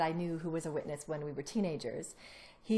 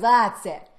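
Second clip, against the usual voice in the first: firm decision; 3 semitones higher, 12 dB louder than usual. Retold or imitated. Student's decision to leave.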